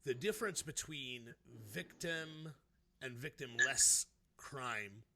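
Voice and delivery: normal talking voice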